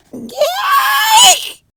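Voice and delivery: very high, loud squealing yay; delighted squeal. Acted. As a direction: shriek with glee.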